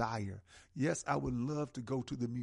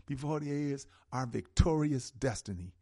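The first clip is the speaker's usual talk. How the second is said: usual speaking voice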